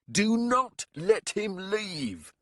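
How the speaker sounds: slow, loud, high plea; urgent shout. A narrator's reading. Warlock begging captain to hold the man, slow loud shout. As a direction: drag out each word, desperate. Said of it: "he shouted".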